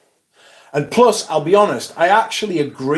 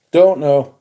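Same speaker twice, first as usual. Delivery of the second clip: louder, firmer second time; emphatic repeat; 5 dB louder than usual. Real, not acted. Repeating the answer to chat, firm and louder. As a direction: say it firmly this time.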